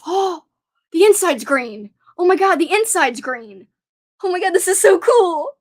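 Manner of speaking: thrilled discovery, really loud; excited gasp. Real, not acted. Gasps at green chocolate inside.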